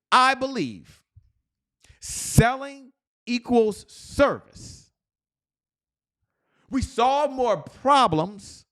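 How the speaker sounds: high, slow rallying affirmation with long pauses; fired-up excitement. Shown as a demonstration. Coach leads listeners repeating the belief statement, excited declamation. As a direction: proclaim each line high and stretched, for repeating. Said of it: "you can hear the excitement in my voice".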